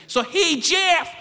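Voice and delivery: high, perky nurse call. Acted. Nurse hailing patient by the wrong name, high call. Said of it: "nurse says".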